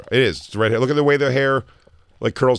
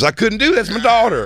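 high mocking dad voice; 12 semitones higher, 3 dB louder than usual. Mocking the father.

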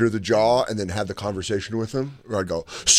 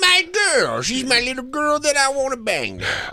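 exaggerated high-pitched creepy impersonation; mock creep; 13 semitones higher than usual. Mocks creep from the show.

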